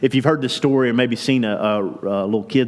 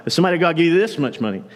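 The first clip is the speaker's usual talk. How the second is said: hurried repeat; 5 semitones higher, much faster than usual. Retold or imitated. Saul repeating the reward, hurried.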